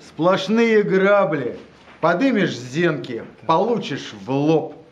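declaiming a poem to a live audience; dramatic poetry declamation. Acted. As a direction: recite the verse with weight and rhythm. Poet reads own poem aloud.

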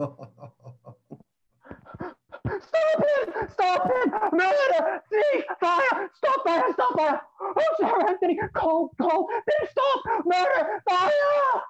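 high shouts of murder and fire; crying alarm. Acted. Servant rushes in to warn Sir Anthony, panicked shouting.

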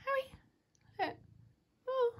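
high cooing pet call; cat voice. Real, not acted. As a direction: call your cat sweetly. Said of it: "talks to their cat in a cat voice".

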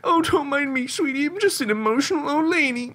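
put-on high, emotional old granny; tearful granny saying goodbye; old lady voice. Continues auntie voice.

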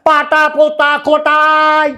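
slow, drawn-out street hawker's cry; ফেরিওয়ালার হাঁক. Retold or imitated. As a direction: stretch it like a vendor calling down the lane. Hawker calling out wares. (ছেলেকে দেখিয়ে দেওয়া, ধীরে টেনে টেনে ফেরিওয়ালার হাঁক)

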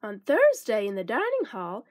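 usual speaking voice

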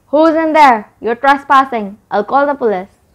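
calling out. A narrator's reading. Granddad to trespassers in the barn, loudly threatening. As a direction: shout a warning through the door. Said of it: "called a voice they all knew from outside".

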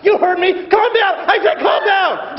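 loud, high yelling at the crowd; furious shouting. Acted. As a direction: scream orders like a cop losing control. Cop screaming at crowd.

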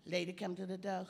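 ordinary talk, nothing marked

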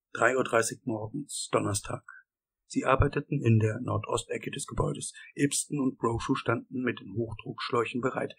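plain reading voice

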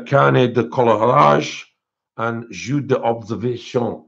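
attempted French accent. Shown as a demonstration. Reading a French book title to viewers, amateur French accent. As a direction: read it out in a careful French accent. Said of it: "if that French accent was shit".

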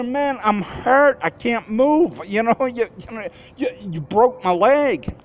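high, pleading protest with a short laugh; begging the driver who's leaving; retold pained plea. Retold plea after injury.

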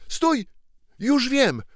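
sudden high excited shout; excited cry. Acted. Ragged claimant to the throne remembering the seal, shouting excitedly. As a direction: cry out as the answer hits you. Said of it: "zawołał ... w najwyższym podnieceniu".

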